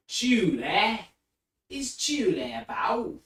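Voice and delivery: high, loud mimicked North of England accent; Northern English neighbor. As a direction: imitate a Northern English neighbor asking at the door. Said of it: "neighbor who was from the north of England".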